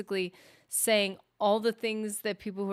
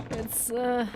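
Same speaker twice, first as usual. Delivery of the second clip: ordinary delivery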